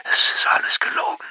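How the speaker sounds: faint breathy whisper over a crackling speakerphone; barely voiced, fragile and worn out; weak and brittle. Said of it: "Sie ist schwach und brüchig".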